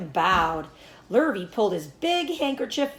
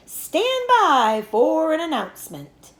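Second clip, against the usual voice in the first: higher, louder, slow announcer call; booming loudspeaker; 8 semitones higher, 6 dB louder, slower than usual. A narrator's reading. Announcer calling the fair crowd to attention, booming. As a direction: command the whole grandstand. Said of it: "boomed the voice of the loudspeaker".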